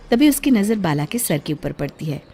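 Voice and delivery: plain reading voice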